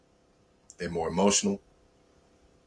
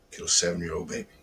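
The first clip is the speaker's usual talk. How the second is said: usual speaking voice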